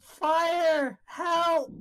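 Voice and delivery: high, drawn-out cry; calling for help. Acted. Trapped woman calls for rescue, high distressed cry.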